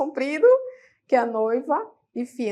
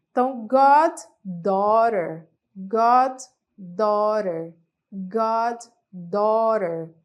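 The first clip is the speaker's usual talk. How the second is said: goddaughter drawn out slowly and louder; slow model word; 4 dB louder, much slower than usual. Shown as a demonstration. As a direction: say goddaughter slowly for students to repeat. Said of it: "virou afilhada". (goddaughter bem devagar e mais alto)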